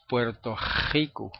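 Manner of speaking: "Puerto Rico" with a throaty kh for the R; scraped velar R opening "Rico"; Puerto Rican R. Shown as a demonstration. Puerto Rican teacher showing his people's habit, velar R. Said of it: "como los puertorriqueños pronunciamos la R".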